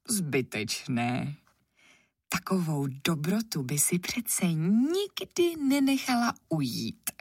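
plain reading voice

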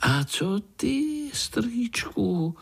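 put-on high-pitched, slow child-like question; curious little spider. A narrator's reading. Pája asks uncle what happened next, curious. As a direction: ask high and drawn out, curious. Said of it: "zeptal se Pája".

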